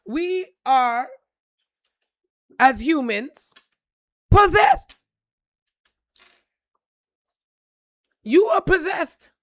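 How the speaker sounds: fired-up preaching. Real, not acted. Preacher builds to angry peak.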